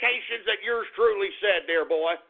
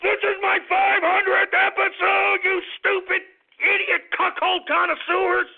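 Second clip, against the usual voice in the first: angry rant; 10 semitones higher than usual. Real, not acted. Host ranting at listeners.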